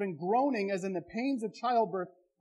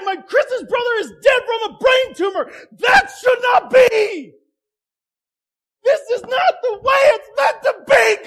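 crying out to God, much louder and higher, voice breaking; anguished lament; 10 semitones higher, 12 dB louder than usual. Acted. Preacher voicing the congregation's cry to God, anguished shout. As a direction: wail the questions at heaven like a grieving man.

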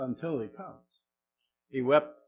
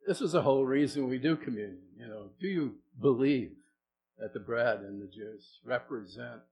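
ordinary talk

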